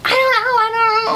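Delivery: very high, panicky squeal; hammed-up flustered squeak; mock frantic. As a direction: squeak like girls caught out, scrambling for an excuse. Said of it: "frantically trying to find an answer".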